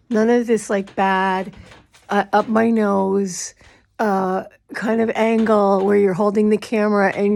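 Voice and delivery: loud, exaggerated scolding; loud mock scold. Minister scolding viewers over bad camera angles, loud mock scold.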